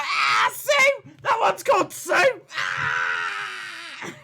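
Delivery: high, loud hammed-up yell of delight; mock shouting. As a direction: yell the name like a crowd going wild. Mock cheering at a silly name.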